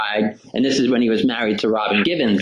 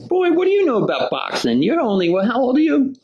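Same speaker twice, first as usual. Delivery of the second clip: much higher, loosely mimicked gruff boxer talking down to a kid; Joe Frazier voice; 12 semitones higher than usual. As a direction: tease the little boy from high above. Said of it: "He's like".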